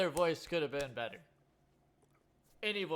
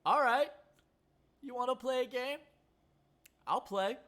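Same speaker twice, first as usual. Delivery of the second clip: high, loud defiant reply; excited; 11 semitones higher, 5 dB louder than usual. Retold or imitated. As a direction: fire it back high and eager. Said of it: "And he's like".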